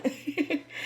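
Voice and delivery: a quick high little laugh at herself; short laugh. Real, not acted. Vlogger laughing at herself to viewers, light laugh.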